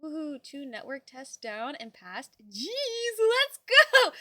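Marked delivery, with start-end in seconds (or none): 2.4-4.1 high, loud whoop of joy; excited cheer. Real, not acted. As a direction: cheer them on at full volume. Cheering a friend's passed tests.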